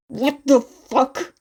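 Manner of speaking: loud, slowed curse; loud swearing. Acted. Kacchan startled by an explosion, swearing loudly. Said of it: "Kacchan swore loudly".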